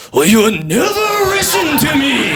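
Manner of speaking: high, slow, drawn-out caricature; mock girlfriend impression. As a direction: wail it as a hammed-up complaining girlfriend. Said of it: "She just kept being like".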